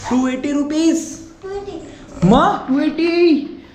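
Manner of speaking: slow, high, drawn-out mocking of the price. Father teasing his son about the costly cake, slow and high. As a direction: savour the number like a joke.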